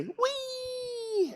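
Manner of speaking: high long squeal; roller coaster wee. Shown as a demonstration. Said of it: "like you're on a roller coaster".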